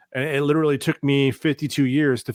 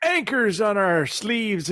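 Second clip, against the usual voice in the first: put-on high, slow cranky old geezer; old man voice; 11 semitones higher, slower than usual. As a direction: grumble like a crotchety grandpa. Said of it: "I know I sound like a very old man right now".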